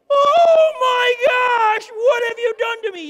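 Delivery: high, loud, drawn-out shriek of panic; hammed-up slow-motion wail; mock terrified scream. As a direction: scream it as if thrown through the air. Holly flying through the air.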